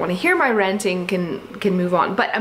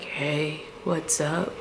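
lower, much softer laid-back greeting; cool rock star; 5 semitones lower, 9 dB quieter, much slower than usual. Retold or imitated. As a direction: lean in low and casual like a cool guy. Imitating the rock star's greeting.

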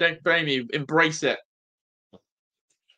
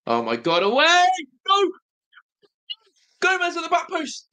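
rising shout of relief; real tense fan shout; excited yell; 11 semitones higher than usual. Real, not acted. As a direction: yelp as the ball is cleared. Fan watching a tense match.

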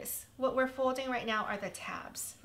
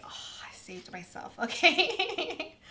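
relieved laugh; 5 semitones higher than usual. Real, not acted. Relieved after near mistake.

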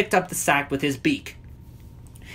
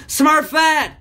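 high, loud warning shout; urgent high-pitched call; alarmed cry; 10 semitones higher, 5 dB louder than usual. A narrator's reading. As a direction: yell her name to save her. Hefty cries out, jumping in front.

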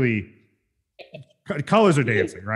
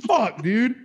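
baffled; 10 semitones higher, slower than usual. Retold or imitated. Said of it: "I'm just like".

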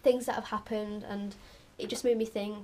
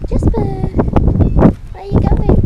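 loud, high, slow call stretched out; calling the cat; 9 semitones higher, 19 dB louder, much slower than usual. Real, not acted. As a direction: shout after the cat as he runs.

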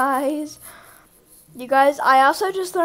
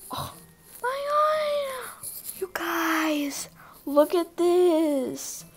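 awed whisper; 4 semitones higher, 6 dB quieter, much slower than usual. Real, not acted. Awed by the new area.

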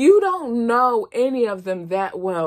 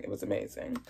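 whisper; 9 semitones lower, 11 dB quieter, much faster than usual. Real, not acted.

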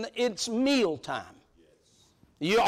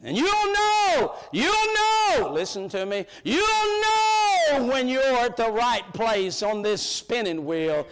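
preaching climax; 2 semitones higher, slower than usual. Real, not acted. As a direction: ring it out to the back pew, slowly.

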